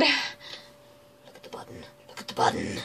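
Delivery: creepy whisper. Acted. Gamer pointing out the button, eerie whisper.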